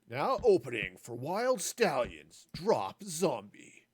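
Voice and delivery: slow, loud announcer voice; band announcer. Pretend. Announcing a fake metal band.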